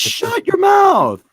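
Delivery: high, drawn-out yell of feigned outrage; mock exasperated shout. Co-host retelling his reply to Jeff's weekly yep, mock exasperated shout. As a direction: shout it high, stretching the words out. Mock outburst in retold exchange.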